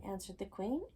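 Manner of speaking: plain reading voice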